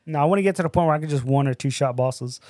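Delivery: ordinary delivery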